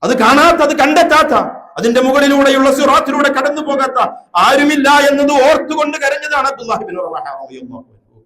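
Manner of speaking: very loud, high, insistent declamation; impassioned preaching. Real, not acted. Preacher warns that all must pass over hell, impassioned preaching. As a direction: cry out each clause from the pulpit.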